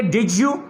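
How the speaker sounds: "Did you" joined as "did-ju"; j liaison. Shown as a demonstration. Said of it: "तो आप बनेंगे".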